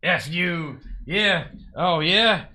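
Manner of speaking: loud, high mock shouting, drawn out; imitated shouting. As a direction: imitate a man hollering from across the lot. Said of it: "I hear someone shouting in the background".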